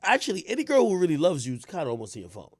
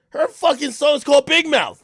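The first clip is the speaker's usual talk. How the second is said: outraged shout; 12 semitones higher, 10 dB louder than usual. Real, not acted. Venting to his listeners, shouting out the title. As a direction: shout it much higher and louder, fed up. Repeats the point, now shouting.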